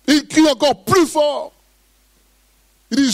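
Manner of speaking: high, climbing preacher's voice, building in fervour; fervent preaching. Acted. Pastor preaches the blind man's cry to the church, fervent. Preaching rises to full force.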